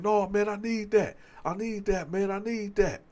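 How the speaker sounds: retold attacker, pressing and repetitive; insistent. Attacker to the man backing away, insistent.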